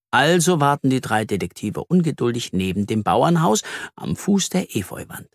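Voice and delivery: usual speaking voice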